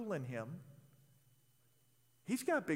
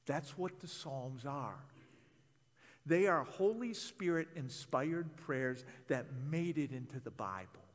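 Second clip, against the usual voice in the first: ordinary talk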